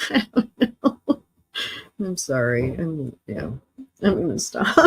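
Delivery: laughing through the words, breaking into whispers; can't stop giggling. Real, not acted. Giggling fit.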